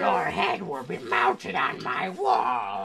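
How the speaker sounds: slow booming pirate threat; villain threat. Acted.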